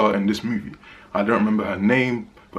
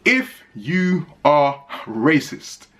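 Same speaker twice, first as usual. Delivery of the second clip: very slow, word by word, pitched up; pointed warning; 5 semitones higher, much slower than usual. Real, not acted. Reviewer addressing certain viewers, slow pointed warning. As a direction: spell it out slowly for emphasis, higher voice.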